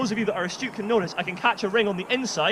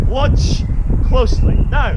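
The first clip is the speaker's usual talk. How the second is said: showman cue; 3 semitones higher, 9 dB louder, slower than usual. Acted. Showman cueing the audience.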